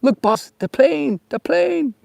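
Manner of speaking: mock Fantasy Island sidekick calling the boss; Fantasy Island impression. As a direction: shout it like Tattoo spotting the plane. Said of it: "remind you of Fantasy Island".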